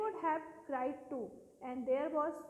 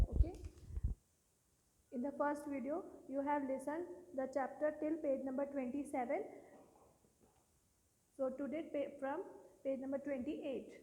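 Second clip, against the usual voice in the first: usual speaking voice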